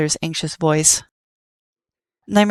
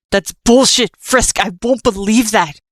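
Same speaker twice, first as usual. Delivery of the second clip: loud, higher, furious denial; angry outburst; 4 semitones higher, 4 dB louder than usual. Acted. Nightmare refusing to believe Frisk, furious shout. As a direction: throw the excuse back at him.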